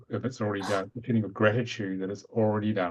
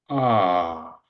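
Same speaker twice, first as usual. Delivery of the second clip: a long, louder, open ah held steady; toned ah; 3 semitones lower, 7 dB louder than usual. Acted. Breathwork leader toning a long ah with the group. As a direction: sound the ah from the base up to the crown. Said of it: "just make the R sound".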